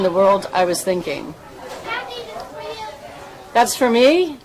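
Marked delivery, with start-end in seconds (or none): 3.5-4.4 surprised. Real, not acted. Gets a surprise gift on camera.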